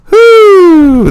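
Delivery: high loud whoop; whooping cheer. Real, not acted. Celebrating the odd title line with a loud high whoop. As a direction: cheer for your own joke.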